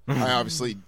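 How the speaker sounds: normal talking voice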